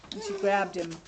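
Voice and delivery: usual speaking voice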